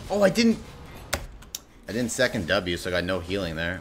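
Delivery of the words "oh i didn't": high, loud yelp of dismay; dismayed realization. Real, not acted. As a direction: blurt it out as the mistake hits you. Realizing a mistake in game.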